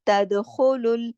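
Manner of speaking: तदखुलुल slow with rasping throat ख; slow Quranic reading of तदखुलुल; tajweed recitation. Shown as a demonstration. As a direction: recite तदखुलुल with heavy guttural ख. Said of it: "गले से खराश के साथ पढ़ा जाएगा".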